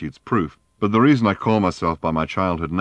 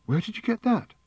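a much higher sharp question with a catch of breath; sharp gasping question. A narrator's reading. Fenchurch demands where Wonko got the bowl, high and sharp. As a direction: snap it out, high and startled. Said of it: "said Fenchurch sharply, and with a gasp in her voice".

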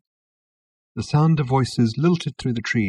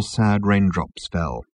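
plain reading voice

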